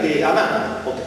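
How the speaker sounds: ordinary talk, nothing marked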